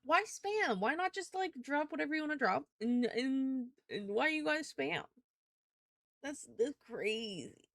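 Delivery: high, bewildered playful scolding; mock outrage. Streamer teasing chat for spamming notifications, playfully outraged.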